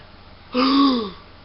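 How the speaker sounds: sharp, high gasp of realisation; shocked gasp. Acted. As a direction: gasp as the truth hits.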